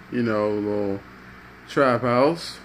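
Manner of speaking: plain reading voice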